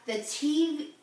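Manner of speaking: usual speaking voice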